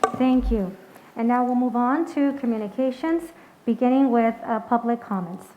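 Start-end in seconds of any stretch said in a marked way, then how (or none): 0.0-0.1 group recitation in unison; reciting pledge. Real, not acted. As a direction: recite it together steadily.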